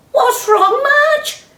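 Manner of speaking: high, loud clucky hen voice; clucking chickens. Acted. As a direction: chatter like a fussy hen. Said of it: "clucked the chickens".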